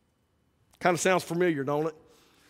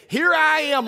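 higher, louder cry; eager answer; 8 semitones higher, 5 dB louder than usual. Retold or imitated. Isaiah answering the Lord, eager outcry. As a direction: answer the call boldly.

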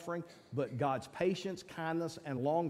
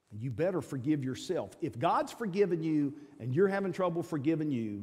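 ordinary delivery

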